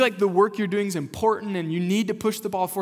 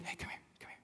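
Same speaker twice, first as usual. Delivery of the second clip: soft whisper; hushed beckoning; 22 dB quieter, much faster than usual. Retold or imitated. Jesus calls a little kid over, whispering. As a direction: coax a small child over in a whisper.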